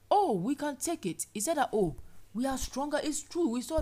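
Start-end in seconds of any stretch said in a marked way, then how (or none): 0.1-1.3 confident cry. Acted. Voicing Joshua and Caleb. 1.7-3.8 confident cry. Acted. Voicing Joshua and Caleb.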